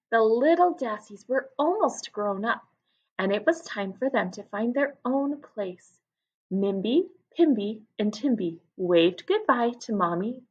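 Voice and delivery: put-on lively read-aloud for children; story narration. A narrator's reading. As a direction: tell it to a class of young kids. Reads story aloud to class.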